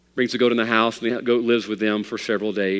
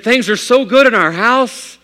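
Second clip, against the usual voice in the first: loud, much higher, bursting with joy; excited; 11 semitones higher, 9 dB louder than usual. Acted. Father of nine to his preacher, overjoyed. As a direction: gush it out, delighted. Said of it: "so excited, so happy".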